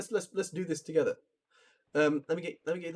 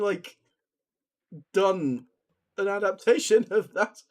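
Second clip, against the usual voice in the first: ordinary talk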